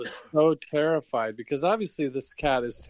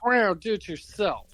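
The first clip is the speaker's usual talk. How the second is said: catty meow; 7 semitones higher than usual. Acted. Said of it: "Yeah, she's like".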